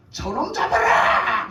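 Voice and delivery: a slow, drawn-out royal order to seize him; commanding shout. Acted. A king pointing at the prophet, ordering his men to seize him, slow and loud. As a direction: bark the order like an angry king.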